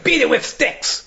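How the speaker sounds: comic high-pitched outburst; mock vehemence. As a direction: pitch jumps up on the punchline. Mock-violent end of joke.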